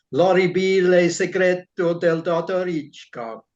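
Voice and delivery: Italian title in a Derbyshire accent. Shown as a demonstration. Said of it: "try and pronounce the Italian title in my Derbyshire accent".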